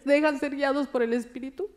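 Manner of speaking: ordinary talk, nothing marked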